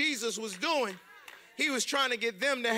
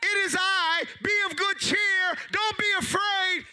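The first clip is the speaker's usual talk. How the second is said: high, loud call over the wind; calling over the storm; 8 semitones higher, 4 dB louder than usual. Acted. Jesus calling to the frightened disciples, loud and high. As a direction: shout it over a raging storm. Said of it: "he screams over the storm".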